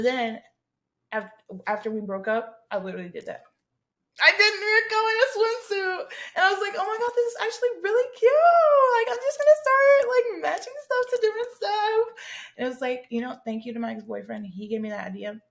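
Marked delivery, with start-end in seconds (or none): 4.2-12.1 very high, loud gushing; excited squeal. Retold or imitated. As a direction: squeal with hammed-up delight. Retells delighted cosplay discovery.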